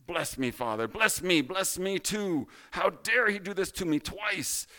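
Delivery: high, aggrieved protest, building after the first plea; plead, then rise into indignant anger; angry outcry. Acted. Wronged big brother acted out to the group, angry outcry. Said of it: "so angry".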